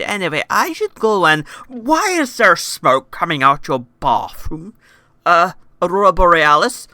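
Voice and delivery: mock-innocent questioning; suspicious officer. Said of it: "it went down like this".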